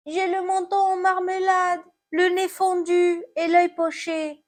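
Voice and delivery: high, plaintive apple voice; hurt apple. Acted. Fallen apple answers about its injuries, high lament.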